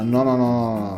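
ordinary delivery

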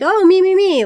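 put-on high, loud begging "me, me" like self-absorbed kids; eager mock kids. Mocking the school kids clamoring for a photo, high and eager. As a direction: squeal for the camera.

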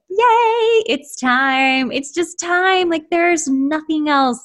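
high, thrilled cheer; excited inner yay. Retold or imitated. Her being cheering about life coaching, high and thrilled. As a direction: cheer like your whole being says yes. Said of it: "everything in my being was like".

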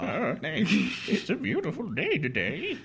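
put-on frail old man asking for help; creaky old-timer voice. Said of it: "a frail old man".

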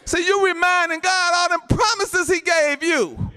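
high, sustained pulpit cry; fervent preaching. Real, not acted. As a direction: drive it home to the congregation. Driving the point home.

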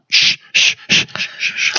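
soft unvoiced shh; urgent repeated shushing. Real, not acted. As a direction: hush them so no spoilers slip. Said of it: "hushing spoilers".

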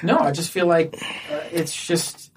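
ordinary talk

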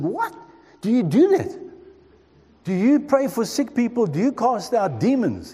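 put-on a boy amazed by what he hears; astonished questions to a school friend, pitched high. Astonished at Pentecostal claims.

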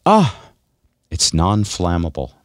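normal talking voice

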